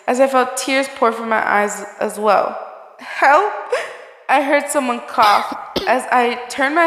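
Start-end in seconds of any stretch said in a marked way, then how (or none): 3.0-3.5 calling for help. Acted.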